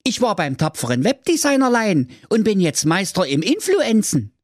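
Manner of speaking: put-on high, proud young son voice. Son bragging to his father, high and proud. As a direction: boast like a puffed-up apprentice. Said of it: "sprach der Klaus eins voller Stolz".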